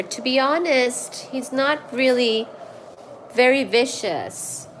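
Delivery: ordinary talk, nothing marked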